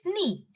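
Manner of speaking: "knee" pronounced nee; silent K. Shown as a demonstration. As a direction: say "knee" with only the n sound first. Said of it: "The pronunciation of it is just".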